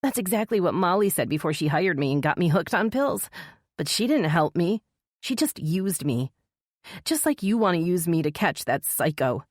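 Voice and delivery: bitter. A narrator's reading. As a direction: spit the words out bitterly. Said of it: "spit out the words".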